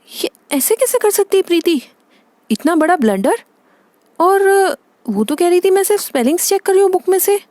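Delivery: high, exasperated outburst; appalled disbelief. Acted. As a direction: react aghast at the child's blunder. Mother learns of daughter's blunder.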